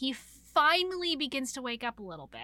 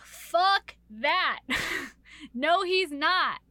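loud, higher, emphatic refusal; defiant retort; 5 semitones higher, 5 dB louder, much slower than usual. Retold or imitated. Heroine refusing to give him up, defiant retort. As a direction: act out a defiant comeback, punching each word.

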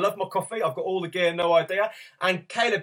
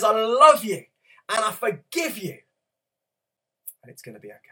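proclaimed promise; 5 semitones higher, 5 dB louder than usual. Acted. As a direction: proclaim God's love to the congregation. Preacher voicing God's message.

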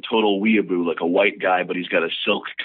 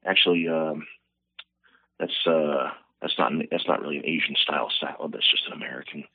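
lower, softer, measured correction; calm aloud voice; 7 semitones lower, 6 dB quieter than usual. Acted. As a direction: play it composed and polite. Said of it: "he's looking normal, and he says".

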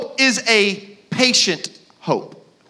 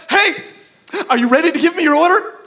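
lightly imitated peppy server, pitched up; mock-eager order taking, fast; overeager happy waitress; 6 semitones higher, 4 dB louder than usual. Waitress coming back for the order, overly happy. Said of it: "it was almost, like, edgy".

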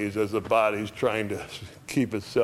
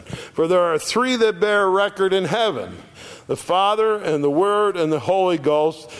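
raised, ringing scripture reading; louder, higher preached verse; preaching scripture; 6 semitones higher, 5 dB louder than usual. Acted. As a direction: proclaim it to the congregation. Reads scripture with force.

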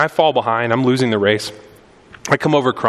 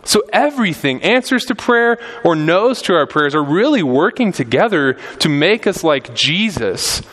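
higher, building sermon cry; impassioned preaching; 6 semitones higher than usual. Acted. Pastor urging his church, preaching at full force. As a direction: preach it out to the congregation.

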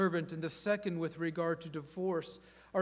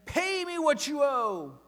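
higher, louder demand for the debt; demanding payment; 11 semitones higher, 9 dB louder than usual. Acted. The servant choking his fellow servant, harsh demand. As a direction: bark it like a creditor owed money. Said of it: "that tone of voice because that is my tone".

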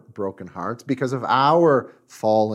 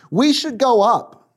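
high, loud call to the crowd; rallying cry; 10 semitones higher, 5 dB louder than usual. Acted. Caleb urging the people before Moses, rallying cry. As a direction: call it out boldly, pitched up.

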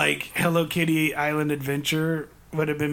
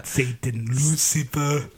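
lower, slow drawn-out hero voice; mock Arnold; 3 semitones lower, much slower than usual. Friend playing Arnold calling out Lucifer, slow and deep. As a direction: stretch out the name like a movie villain-hunter. Acting out Arnold fighting Satan.

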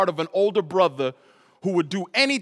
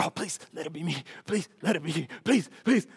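begging sister; 2 semitones higher, 3 dB quieter than usual. Retold or imitated. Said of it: "my sister's like".

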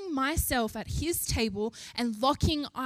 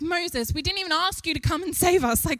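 mildly mocking the panicky Israelites, higher and louder; freaking-out complaint; 5 semitones higher, 3 dB louder than usual. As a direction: act out a silly, freaked-out protest, lightly hammed-up. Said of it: "they're saying silly things like".

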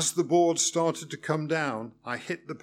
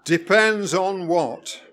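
irritated; 4 semitones higher, 7 dB louder, slower than usual. A narrator's reading. Examiner irritated question.

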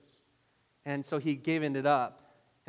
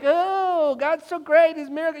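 high, loud show of praise; mock gushing; 11 semitones higher, 11 dB louder than usual. Believer gushing at someone stuck in a mess, mock gushing.

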